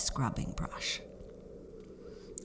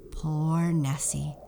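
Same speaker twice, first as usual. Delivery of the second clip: ordinary delivery, nothing marked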